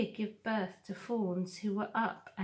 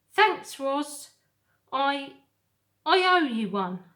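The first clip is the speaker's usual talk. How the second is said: louder, higher fox voice, grateful; 6 semitones higher, 10 dB louder than usual. Acted. Fox thanks Roz after the quills, grateful. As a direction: thank her in the fox's voice.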